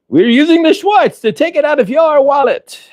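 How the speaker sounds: very high, hammed-up character voice; movie parody voice. Spaceballs figure after your wallet, high cartoonish voice.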